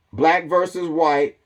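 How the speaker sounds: ordinary delivery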